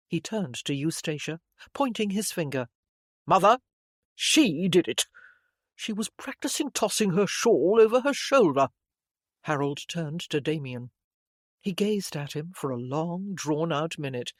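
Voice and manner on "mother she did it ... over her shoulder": indignant tattling. A narrator's reading. Brother tells on sister to mother.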